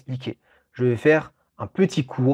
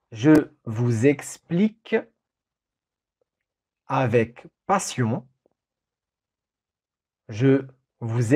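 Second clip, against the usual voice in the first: dictating. Shown as a demonstration. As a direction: read it out for a dictation. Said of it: "Je répète cette phrase, cette longue phrase".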